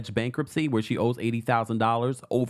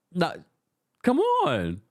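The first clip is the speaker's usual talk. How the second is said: high protest; 11 semitones higher, much slower than usual. Real, not acted. Protesting the co-host.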